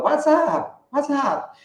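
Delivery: high, drawn-out "WhatsApp" run into one word; run-together greeting. Retold or imitated. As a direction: imitate the ad's high-pitched slurred call. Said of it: "as pessoas chegam perguntando". (imitando o cumprimento emendado, bem agudo)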